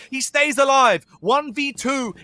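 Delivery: rising, urgent play-by-play call; esports caster. Acted. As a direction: call the clutch as it happens.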